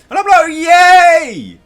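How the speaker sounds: very high, very loud drawn-out cheer; mock cheering yell. Fan reacting to Peaches joining the crew, hammed-up cheer. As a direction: whoop it out at full volume, hammed-up. Acts out own delight at scene.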